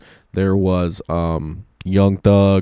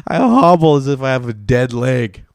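ordinary talk

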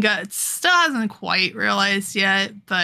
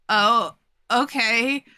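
taken aback; 3 semitones higher, 3 dB louder, slower than usual. Retold or imitated. Guts taken aback by rejection.

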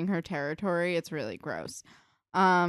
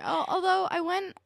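ordinary talk, nothing marked

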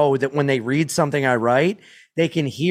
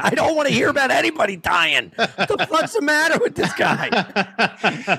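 mock outraged viewer; 8 semitones higher than usual. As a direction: play a disgusted onlooker. Mock indignant viewer, laughing.

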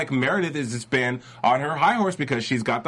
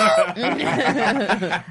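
spooky cackle; a creepy villain laugh; scary laugh; 9 semitones higher, 4 dB louder than usual. Real, not acted. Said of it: "your, like, scary voice showing".

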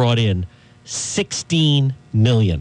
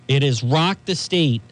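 ordinary talk, nothing marked

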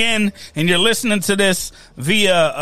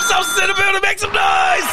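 hype man; 10 semitones higher than usual. Acted. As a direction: pump up the crowd.

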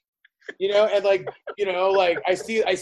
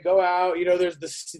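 usual speaking voice

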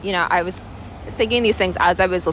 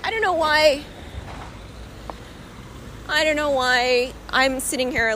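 on the verge of tears; 5 semitones higher than usual. Real, not acted. Tearful in public after grief.

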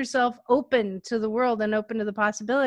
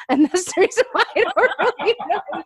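laughing; 6 semitones higher than usual. Real, not acted. Laughing at her own punchline.

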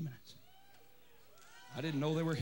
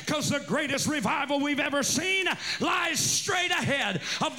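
loud, high, shouting revival preaching; full-force preaching; 7 semitones higher, 4 dB louder than usual. Acted. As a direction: preach it to the rafters, building to a roar.

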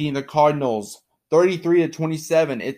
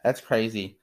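ordinary talk

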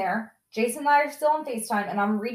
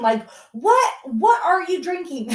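mock yell; 7 semitones higher, 5 dB louder than usual. Mom yelling at drunk son.

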